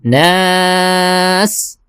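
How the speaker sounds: slow, high chanted الہن; Quran recitation. Shown as a demonstration. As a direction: recite it drawn out and melodic. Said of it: "آخری جو لفظ ہے وہ ہے". (بلند، دھیمی تلاوت کی آواز)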